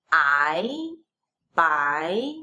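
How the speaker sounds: "by" dipping low then sweeping up; rising second tone. Shown as a demonstration. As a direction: stretch "by" upward like a question. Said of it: "rising tone".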